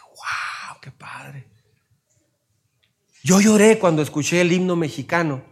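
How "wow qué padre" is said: breathless whispered wow; awed whisper. Retold or imitated. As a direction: whisper it in amazement.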